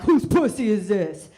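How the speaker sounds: loud, aggressive demand. Retold or imitated. Comic demanding the guy in bed, loud and aggressive. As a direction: bark it possessively. Aggressive bedroom talk retold.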